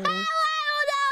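ordinary talk, nothing marked

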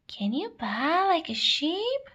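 ordinary talk

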